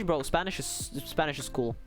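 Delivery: ordinary talk, nothing marked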